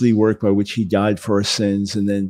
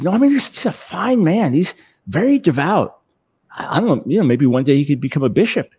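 higher put-on voice, a little taken aback; 6 semitones higher than usual. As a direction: play the proud father caught off guard, pitched up.

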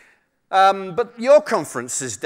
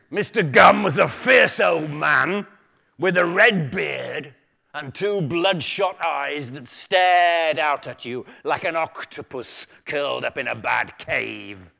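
dramatic story reading; 5 dB louder than usual. A narrator's reading. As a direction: relish each phrase, pausing between items. Reads a book taster aloud dramatically.

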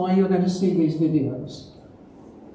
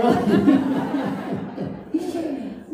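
amused chuckle; 5 semitones higher than usual. Real, not acted. Pastor laughs at own joke.

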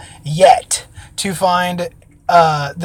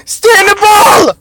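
high, slow, drawn-out yell; will the ball to hold, loudly; urgent shout; 6 dB louder, much slower than usual. Real, not acted. Urging the ball during catch.

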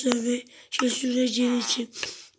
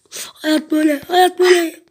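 rising louder and higher, sing-song; hammy shrug of resignation, growing louder; mock philosophical; 5 semitones higher, 9 dB louder than usual. Gamer telling a sad animal life goes like that, hammed up.

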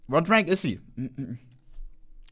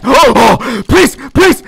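desperate pleading; 7 semitones higher, 9 dB louder, much slower than usual. Real, not acted. Begging during tense moment.

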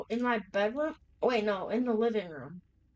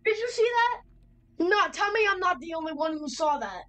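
high-pitched, alarmed disbelief; startled and urgent, pitched up; freaking out; 6 semitones higher than usual. Real, not acted. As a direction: demand a witness, voice jumping up in alarm. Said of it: "I think I'm just freaking out".

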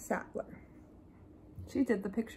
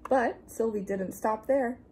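ordinary delivery, nothing marked

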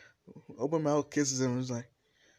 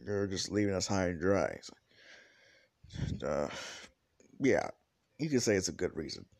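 ordinary delivery, nothing marked

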